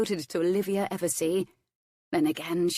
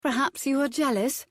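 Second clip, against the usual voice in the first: flirty tease; 8 semitones higher than usual. A narrator's reading. Flirting lady teases the Earl.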